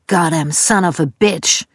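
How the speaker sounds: a slow, restrained exclamation of annoyance; milder cursing. A narrator's reading. Dad cursing from the front of the house, restrained drawn-out curse. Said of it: "ertönte ein weiteres".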